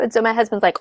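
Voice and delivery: ordinary talk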